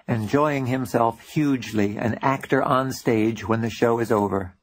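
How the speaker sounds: ordinary delivery, nothing marked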